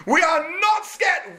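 high, loud, slow shout; forced bravado. Retold or imitated. King shouting to scared soldiers, forced bravado. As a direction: try to sound brave.

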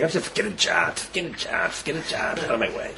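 softly voiced private thought; inner grumble. Reluctant donor to himself about the collector, grumbling under the breath. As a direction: say it low, trailing away under your breath. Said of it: "in your head you're saying".

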